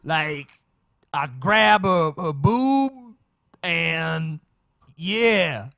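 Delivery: high, loud, drawn-out impersonation; hammed-up mock convert, halting and high-pitched; parody testimony voice. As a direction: mimic the testifying man, stretching the words.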